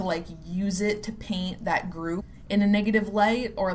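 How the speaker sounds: ordinary talk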